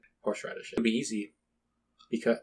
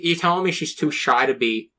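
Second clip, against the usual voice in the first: normal talking voice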